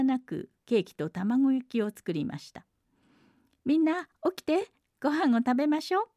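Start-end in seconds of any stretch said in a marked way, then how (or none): 3.6-6.1 put-on high, brisk girlish call to wake everyone; shout. Mameko shouts to wake the sleeping girls, high and quick. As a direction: rouse them all with a quick high cry. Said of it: "豆子が叫ぶと".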